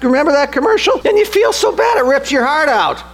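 high-pitched, heart-tugged pleading; plaintive. Real, not acted. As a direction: let the voice climb very high, as if your heart is breaking for the dog. Pleading over a sad commercial.

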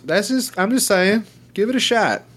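high, mock-innocent encouragement; sarcastic mock-helpful voice. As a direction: egg them on with fake sincerity. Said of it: "sarcastic urging".

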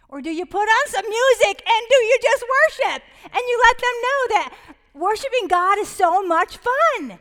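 joyful near-shout about worship; excited. Real, not acted. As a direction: let it ring out like you're already singing. Exuberant joy of family worship.

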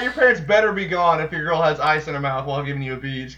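normal talking voice

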